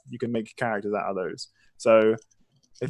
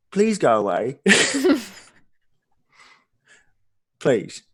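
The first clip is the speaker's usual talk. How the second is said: condescending; 2 semitones higher than usual. Acted. Innkeeper condescending.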